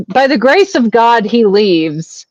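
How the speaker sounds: usual speaking voice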